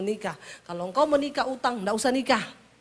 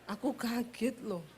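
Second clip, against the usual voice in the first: much softer, slower, sinking tone; soft Solo husband; 11 dB quieter than usual. Retold or imitated. Husband telling wife she startled him, soft slow. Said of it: "Orang Solo i kaget laporan".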